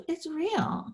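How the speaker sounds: ordinary talk, nothing marked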